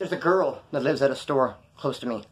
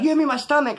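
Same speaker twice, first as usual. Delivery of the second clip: accusing; 10 semitones higher, 4 dB louder, faster than usual. Retold or imitated. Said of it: "he's like".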